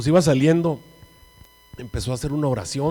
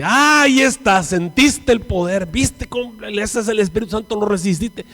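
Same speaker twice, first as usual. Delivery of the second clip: triumphant preacher; 6 semitones higher, 6 dB louder than usual. Retold or imitated. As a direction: crow over a convert as an acted pastor. Acting the pastor's triumphant reaction. (pastor triunfante, exaltado)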